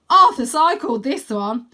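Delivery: loud, slow call over the shoulder; calling back. Acted. Wyn calling over his shoulder while charging, loud. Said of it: "he calls over his shoulder".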